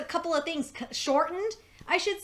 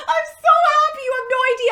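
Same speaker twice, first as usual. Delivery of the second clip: much higher and louder, voice cracking with joy; overjoyed, near tears; 11 semitones higher, 9 dB louder, much faster than usual. Real, not acted. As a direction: cry out your happiness.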